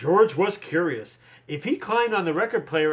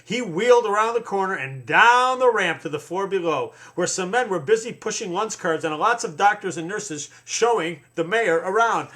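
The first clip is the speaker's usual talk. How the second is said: usual speaking voice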